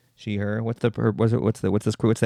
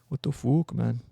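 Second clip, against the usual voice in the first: bad Irish accent; 2 semitones higher than usual. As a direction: try the curse in a clumsy Irish brogue. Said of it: "curse and with different accents".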